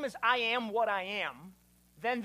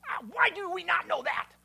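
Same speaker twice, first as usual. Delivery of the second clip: high, loud mock-exasperated cry; hammed-up angry wail of frustration; imitating mad wife; 9 semitones higher, 7 dB louder than usual. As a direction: imitate someone fed up and indignant. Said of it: "she gets so mad. She's like".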